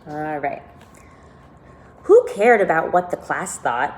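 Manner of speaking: usual speaking voice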